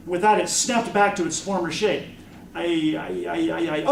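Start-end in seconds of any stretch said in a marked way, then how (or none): 2.6-3.8 stammering. A narrator's reading. Child lost for words before a talking sword, stammering. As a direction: get stuck on the word, over and over. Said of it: "stammering like a drunk".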